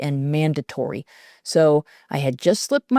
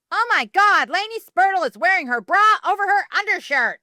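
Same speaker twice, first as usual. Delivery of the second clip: much higher, piercing cruel taunt; shrill mean girl; 13 semitones higher than usual. A narrator's reading. Queen bee mocking Lainey in front of everyone, shrill. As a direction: broadcast it to the whole locker room. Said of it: "shrill voice pierced the silence".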